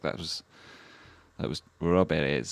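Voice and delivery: normal talking voice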